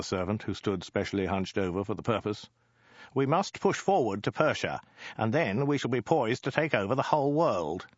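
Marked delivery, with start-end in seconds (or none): 3.1-7.9 high-pitched, pressing voice; high, rousing military pitch; eager war general. Acted. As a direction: urge your ruler on, pitched up. Plays Ogdai urging conquest.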